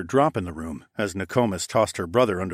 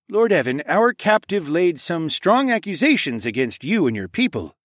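higher, louder voice of authority; stern accusing king; 9 semitones higher, 5 dB louder than usual. Acted. King confronts Lord Evan with accusations, stern and loud. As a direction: put the charge to him loudly.

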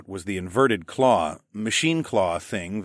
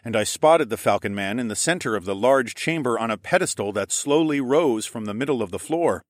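usual speaking voice

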